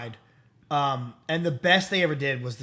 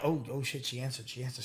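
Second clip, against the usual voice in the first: softer, urgent hushed voice; hushed excited gossip; 6 semitones lower, 13 dB quieter than usual. Retold or imitated. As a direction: hush the room because she picked up. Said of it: "they're all like".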